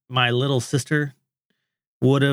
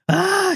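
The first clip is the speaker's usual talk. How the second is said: high frustrated "Ah!"; exasperated yell. Retold or imitated. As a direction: throw up your hands, yelling.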